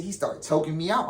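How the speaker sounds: ordinary talk, nothing marked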